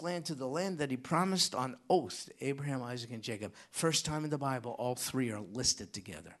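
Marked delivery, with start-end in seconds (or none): none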